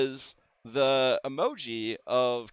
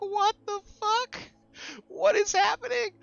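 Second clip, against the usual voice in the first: way up high, stretched out in bewilderment; retold bewildered shock; 3 dB louder than usual. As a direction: squeal it in baffled, mock horror. Retold shock at the animated face.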